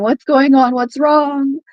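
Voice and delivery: worried crying sisters. Retold or imitated. Younger sisters asking mother about the commotion, retold anxious cry.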